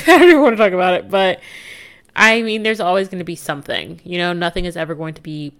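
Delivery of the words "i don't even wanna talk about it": bursts out laughing, much louder and higher; laughing loud repeat. Real, not acted. Vlogger laughing off the upstairs neighbor, loud and giggly.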